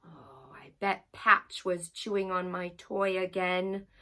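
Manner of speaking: plain reading voice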